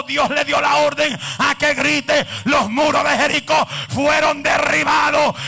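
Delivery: loud preacher's shout, slowing; preaching at full force. Acted. Preacher commanding the church to shout praise, loud and slow. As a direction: drive each word home, then command.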